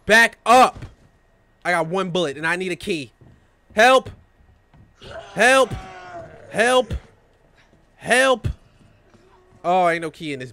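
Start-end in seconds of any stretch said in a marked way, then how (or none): 0.0-0.9 high, loud command; warning shout. Real, not acted. Player warding off an enemy, warning shout. 3.7-8.5 high, loud repeated shouts; calling for help. Real, not acted. Player stuck without a key, calling for help.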